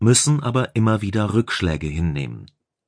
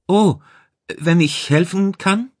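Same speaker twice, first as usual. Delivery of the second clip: much higher, eager-to-help character voice; unsure technician; 11 semitones higher, 4 dB louder than usual. A narrator's reading. Technician outside the block offers the chief help, unsure. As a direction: lift it high and offer help tentatively.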